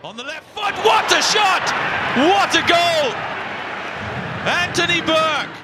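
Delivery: soaring excited goal call; long goal yell. Real, not acted. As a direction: scream it high as the ball flies in. Football commentator goal call.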